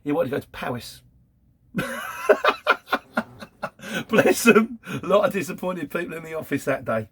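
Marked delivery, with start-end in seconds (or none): 1.7-4.0 high laugh. Real, not acted. Laughing at the story.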